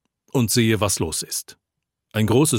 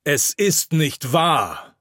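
slow, higher-pitched protest; emphatic, drawn-out denial, mildly shouted; restrained shout; 5 semitones higher, much slower than usual. A narrator's reading. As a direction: call it out slowly against the noise. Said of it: "schreie ich nun in den Lärm".

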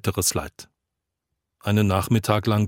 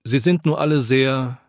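plain reading voice

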